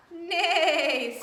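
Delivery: high, loud mock neigh; whinny like a horse; hammed-up horse noise. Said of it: "said Horse".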